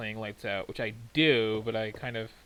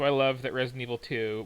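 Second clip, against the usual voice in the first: ordinary delivery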